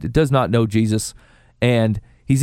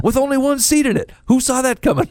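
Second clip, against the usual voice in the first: much higher, incredulous exclaim; mock astonishment; 11 semitones higher than usual. Man acting amazed at an unexpected gift, mock astonishment. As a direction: act out the gasp of disbelief. Mock surprise at God's odd answer.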